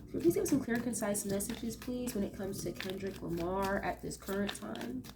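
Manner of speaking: soft, hushed asking of the cards; quiet invocation. Real, not acted. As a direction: ask the spirits gently, like a prayer.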